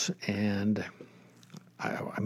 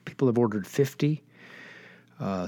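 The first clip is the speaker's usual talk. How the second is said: ordinary talk, nothing marked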